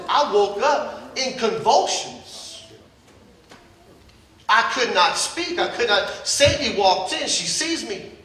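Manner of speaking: ordinary talk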